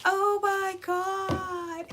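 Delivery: high, very drawn-out dismay; mock upset. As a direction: imitate an upset friend, stretching every word. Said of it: "she was so upset".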